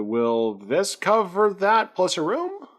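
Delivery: high, slow and hopeful; tentative high character plea; hopeful ask. Acted. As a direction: ask as if hoping for a bargain. Graves bartering.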